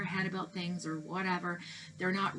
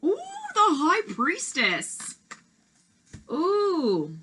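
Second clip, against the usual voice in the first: high, slow, delighted ooh at the card; excited ooh; 9 semitones higher, 6 dB louder, much slower than usual. Real, not acted. Revealing the last card to the viewer, thrilled and drawn out.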